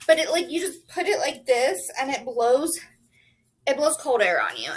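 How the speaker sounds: ordinary talk, nothing marked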